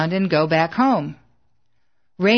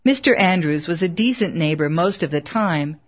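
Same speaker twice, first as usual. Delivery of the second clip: plain reading voice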